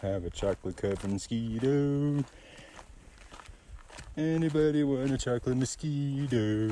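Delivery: hammed-up vendor hawking treats; vendor call. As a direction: call out like a snack seller.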